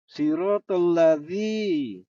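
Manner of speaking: sirotol lazi drawn out slowly; slow recitation. Shown as a demonstration. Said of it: "di gigi atas ya".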